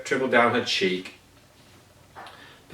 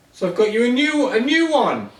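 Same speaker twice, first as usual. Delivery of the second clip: excited; 8 semitones higher than usual. A narrator's reading. Happy delighted with his joke.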